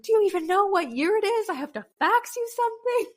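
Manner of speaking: high, exasperated disbelief; retold fury at the doctor's office; mock outrage. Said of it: "because I was so angry".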